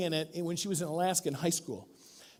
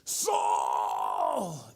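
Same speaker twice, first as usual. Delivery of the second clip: deep booming call; choir shout; 9 semitones lower than usual. Retold or imitated. Choir bursting in on Saul, deep shout. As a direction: boom the name out like a choir. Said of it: "the whole choir just goes".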